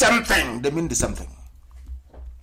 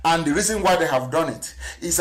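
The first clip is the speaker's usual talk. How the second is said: ordinary talk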